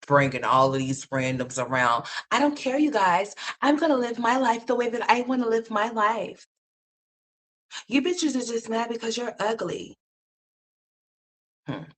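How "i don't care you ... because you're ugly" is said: high mocking airhead voice; lightly voiced carefree woman; mocking Olivia. Mocking the woman she criticizes, high and airy.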